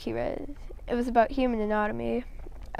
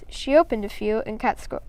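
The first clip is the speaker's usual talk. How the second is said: ordinary delivery, nothing marked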